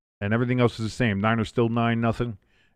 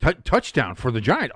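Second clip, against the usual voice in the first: normal talking voice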